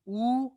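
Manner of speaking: a two-beat dirgha उ; long u. Shown as a demonstration. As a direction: stretch the उ to a long double-length vowel. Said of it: "इनको कैसे बोला जाएगा?".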